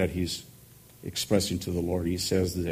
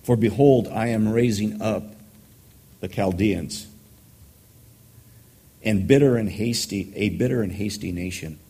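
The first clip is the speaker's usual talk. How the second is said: raised, declaiming voice of God's answer; scripture reading; 3 dB louder than usual. Acted. Preacher reading God's answer to Habakkuk, declaiming. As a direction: proclaim it like a prophet.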